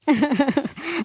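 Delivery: short acted sobbing; tearful sob. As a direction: break into sobs while pleading. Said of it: "ಕಣ್ಣೀರ್ ಆಗ್ತಾ ಇದ್ಯಾ?". (ಅಳುವ ಬಿಕ್ಕಳಿಕೆ)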